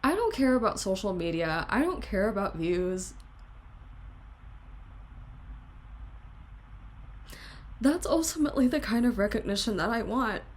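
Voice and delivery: tearful, tight voice; crying. Real, not acted. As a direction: push the words out through tears. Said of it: "now I'm actually crying now".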